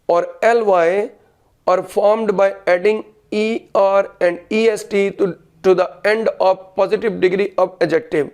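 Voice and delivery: slow, deliberate dictation pace. Shown as a demonstration. Teacher dictating a grammar rule to students, slowly. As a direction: spell it out slowly so students can write. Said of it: "A fraction लिखनी है पहले".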